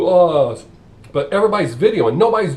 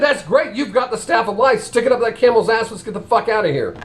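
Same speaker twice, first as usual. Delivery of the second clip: higher, louder brisk excitement; eager nun; 8 semitones higher, 3 dB louder than usual. Acted. Nun urging the priest to use his staff, eager. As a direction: rush it out, ready to leave. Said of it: "She said".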